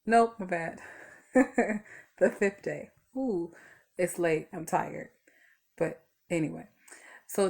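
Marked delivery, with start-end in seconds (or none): 1.3-2.0 a quick embarrassed laugh at herself; short laugh. Real, not acted. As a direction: laugh off the slip. Laughs at own mistake.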